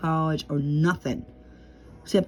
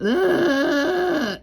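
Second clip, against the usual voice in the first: long, high mock groan; re-enacted drawn-out wail of ugh; imitated drunken groan; 9 semitones higher than usual. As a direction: draw out a high, exaggerated groan. Acts out reaction after drinking.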